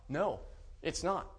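ordinary delivery

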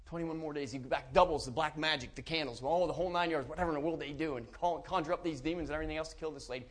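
ordinary delivery

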